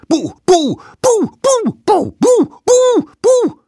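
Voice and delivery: very high, very loud scare shouts; volley of piercing yells; roared boos. A narrator's reading. Little savages ambushing the mammoth, roared boos. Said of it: "brüllten sie".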